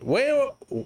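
high, startled mock mom voice. Telling how his mom reacted to his tattoo plan, high startled mock mom. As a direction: squeak it like a shocked mother.

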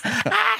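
high laughing cry. Retold or imitated. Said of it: "just going".

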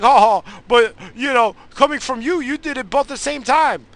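high, loud jeering retort; mocking shout. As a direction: shout it back at him, teasing. [name] retorts loudly.

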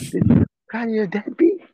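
high-pitched, teasing question; mock taunt. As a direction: imitate a son needling his mother.

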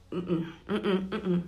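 closed-mouth hums with a laugh; muffled holding back. Acted. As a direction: bite back a spoiler. Evita decir spoiler. (murmullos con la boca cerrada, aguantando la risa)

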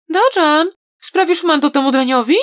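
put-on a girl urging him on; a hint of an excited girl; eager young girl. A narrator's reading. Atsing calls after Daojan.

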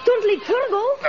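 high, pleading cry not to be left; cling to him with your voice; frightened plea. Acted. Boy fears Tarzan leaving him.

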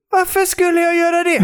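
hammed-up outrage at the idea; mock outraged shout. Asked to mingle, he yells in mock horror.

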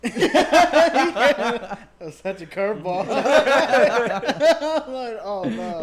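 helpless loud laughter through words; cracking up. Real, not acted. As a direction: laugh hard at a curveball. Cracking up at the curveball.

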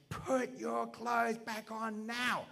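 high, slow, drawn-out command; stern order. Retold or imitated. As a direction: order him firmly from across the park. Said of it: "She's not annoyed. She goes".

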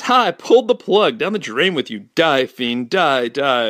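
loud, hammed-up kid vanquishing a monster; high opening, then vengeful mock battle cries; triumphant gloating. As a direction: crow in victory over your foe. Calvin gloats killing bubble monster.

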